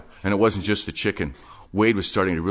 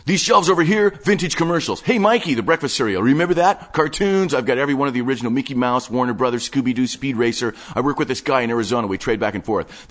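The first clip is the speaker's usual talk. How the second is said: higher, fast-talking obsessive geek; breathless nerdy enthusiast showing off his stash; manic collector Wade; 6 semitones higher, 3 dB louder than usual. Acted. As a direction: rattle it off like an excited hoarder. Wade shows off his archive.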